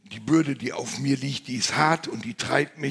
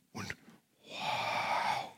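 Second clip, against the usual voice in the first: breathless wow; 8 dB quieter than usual. Real, not acted. Awestruck at Jesus rising.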